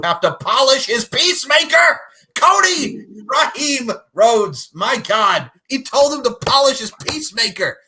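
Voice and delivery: loud, high, hyped-up yelling; excited shout. Real, not acted. A fan hyped over Cody's insult in a loud shout. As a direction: scream it like the best moment of the show.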